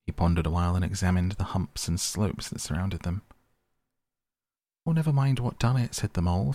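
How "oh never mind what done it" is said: higher, louder pained outburst. Acted. Mole snaps at the rat through his pain, higher and louder. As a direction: brush it off through the hurt. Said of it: "forgetting his grammar in his pain".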